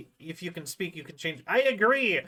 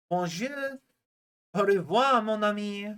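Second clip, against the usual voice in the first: light French accent on a few stock phrases; casual mock French accent. Shown as a demonstration. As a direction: toss off a quick French greeting in a French accent. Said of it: "do French accents".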